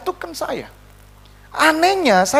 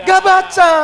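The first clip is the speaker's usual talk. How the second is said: high, loud rallying reply; raised, punchy declaration; emphatic answer; 9 semitones higher, 5 dB louder than usual. Real, not acted. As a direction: call it out to the congregation.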